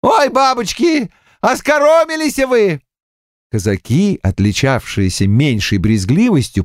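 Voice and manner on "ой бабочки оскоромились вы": high-pitched, amused exclamation; mirthful cry. A narrator's reading. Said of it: "покатываясь со смеху, на силу выкрикнул". (весело выкрикивает, высоким голосом)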